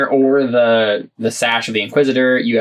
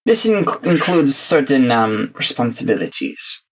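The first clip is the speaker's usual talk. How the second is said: menacing inquisitor; 5 semitones higher than usual. Acted. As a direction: threaten them smugly, drawing it out. Inquisitor threatens prisoners.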